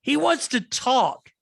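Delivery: a timid goblin pointing at the stranger; nervous minion. Acted. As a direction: pass the buck meekly but loudly. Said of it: "points back at you and goes".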